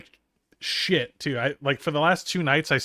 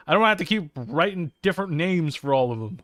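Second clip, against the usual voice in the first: ordinary talk, nothing marked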